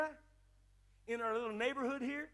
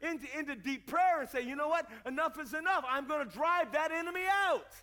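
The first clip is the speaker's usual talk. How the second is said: raised, forceful sermon voice building to a shout; fired-up preaching; 5 semitones higher, 5 dB louder than usual. Acted. Preacher urging the church to drive the enemy out, fired-up preaching. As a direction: declare it with rising force.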